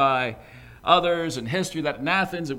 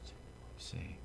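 soft unvoiced whisper; whispered aside; 21 dB quieter than usual. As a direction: lean in and whisper it. Intimate aside after lament.

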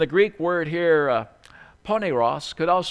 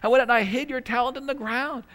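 high, small, whimpery servant voice; like a sad puppy bringing something dug up; whimpering servant; 9 semitones higher than usual. Retold or imitated. Preacher voices fearful servant returning buried talent, whimpery. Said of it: "He says".

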